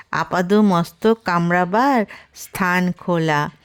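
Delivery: plain reading voice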